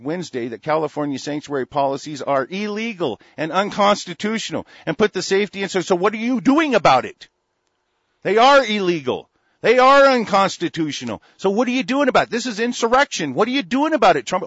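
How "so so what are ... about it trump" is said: loud, high, hammering the question; angry demand. Real, not acted. Ranting at Trump over sanctuary cities, loud angry demand. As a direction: demand an answer, furious.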